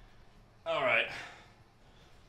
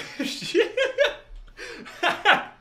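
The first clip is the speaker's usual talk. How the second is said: high burst of laughter; 5 dB louder than usual. Real, not acted. Laughing at own joke.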